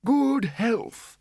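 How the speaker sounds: put-on high, frail old man's toast; old wizard cheerfully toasting the others. A narrator's reading.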